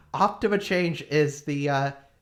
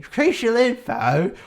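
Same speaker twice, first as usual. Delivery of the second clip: put-on slow Australian accent on Crucial info; 5 semitones higher, 5 dB louder than usual. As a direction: drawl Crucial info in a hammy Aussie accent. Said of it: "I'm Australian now".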